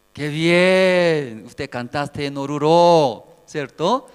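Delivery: loud, drawn-out approval; God praising. Acted. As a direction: stretch the words out, loudly approving.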